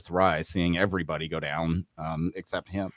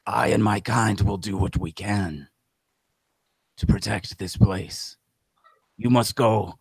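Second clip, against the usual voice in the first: gravelly voice; 2 semitones higher than usual. Acted. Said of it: "I shouldn't do a gravelly voice".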